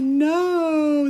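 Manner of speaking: high, loud mock-dismayed no; a wailing no as if heartbroken all over again; retold dismayed no. Hearing her slippers get binned, hammed-up dismay. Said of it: "And I was like".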